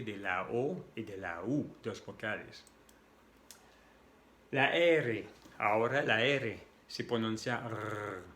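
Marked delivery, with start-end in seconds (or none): 6.2-6.6 "R" rolled at the back of the throat; French throat R. Shown as a demonstration. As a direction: sustain a guttural "R" sound. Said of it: "viene del fondo de la garganta".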